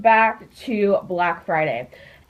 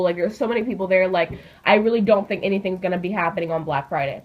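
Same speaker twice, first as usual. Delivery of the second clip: normal talking voice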